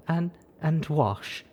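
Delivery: slow, small trembling voice; shaky voice. Acted. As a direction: let the voice tremble. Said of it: "shaky little voice".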